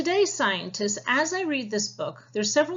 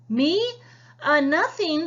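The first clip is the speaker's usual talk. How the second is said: a hint of a caught schoolboy, pitched up; sheepish boy; 7 semitones higher, 6 dB louder than usual. Jojo caught by his teacher, sheepish and higher. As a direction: play it innocent, higher and louder.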